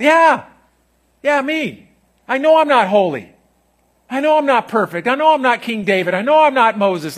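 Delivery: loud, high revival preaching; fiery sermon climax; preaching at full force. Acted. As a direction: lift the room, preach it out.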